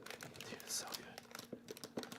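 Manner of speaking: slow airy whisper; hushed and awed. Real, not acted. Hushed delight at the game.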